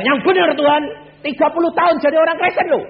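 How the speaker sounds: high, loud, fast retort; indignant protest. Retold or imitated. Proud young man retorts to God, high and loud. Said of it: "Saya bilang".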